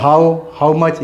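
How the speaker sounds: how, how much drawn out very slowly; slow example. Shown as a demonstration. Teacher listing wh words slowly for students. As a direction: stretch each word of how much. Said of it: "जैसे एच से शुरू होता है".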